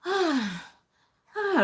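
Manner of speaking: high, mimicked "ah" sigh; dissatisfied sigh. Customer not looking at staff, dissatisfied sigh. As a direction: imitate a displeased customer's huffy sigh. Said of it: "not satisfied at all".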